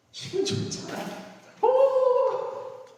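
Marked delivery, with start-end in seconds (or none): 1.6-2.8 a high, wide-eyed exclamation; amazed oh. Shown as a demonstration. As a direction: gasp at a wonder. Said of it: "놀라워".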